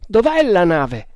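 loud, high wild demand. A narrator's reading. Man urgently looking for the ship, wild tone. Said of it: "chiese con accento selvaggio".

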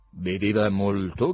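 slow, loud question forced through the teeth; doctor questioning through his teeth, lightly acted. A narrator's reading. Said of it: "chiese il dottore tra i denti". (a denti stretti)

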